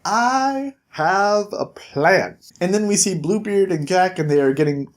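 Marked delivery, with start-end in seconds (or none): 0.1-2.4 very slow, drawn-out ominous line; dramatic reveal. Acted. Bigby tells the Fabletown leaders his plan, slow and ominous. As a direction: savor each word like a schemer. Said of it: "And he's like".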